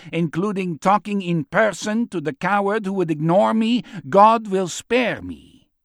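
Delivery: defiant, devout captive. Acted. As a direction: declare it with unshaken faith, slowing at the end.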